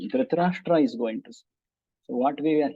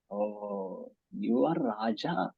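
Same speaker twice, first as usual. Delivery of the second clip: sarcastic; 5 semitones lower, 7 dB quieter, much slower than usual. Shown as a demonstration. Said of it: "sarcastic means indirectly saying something".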